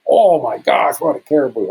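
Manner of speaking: high-pitched, wowed exclamation; imitated amazement. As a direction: gush like an awestruck onlooker. Retold amazed reaction of residents.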